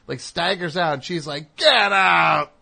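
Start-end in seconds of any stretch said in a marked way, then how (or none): 1.6-2.5 loud, much higher shout. Retold or imitated. Wife shouting the drunk out of the apartment, full yell. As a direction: yell to throw him out.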